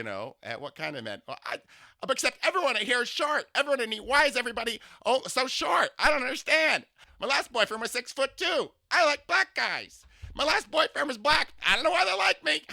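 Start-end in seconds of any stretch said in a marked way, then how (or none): 2.1-12.6 high, loud, sing-song camp voice; imitating a flamboyant older man. To a stranger on the subway, gushing camp impression. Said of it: "I imitate people".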